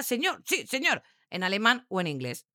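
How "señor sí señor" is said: high-pitched soldier answering a superior; military reply. Acted. Soldiers answering their superiors in daily army life, military tone. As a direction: snap out a soldier's acknowledgment. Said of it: "Ellos hablaban así porque en su día a día".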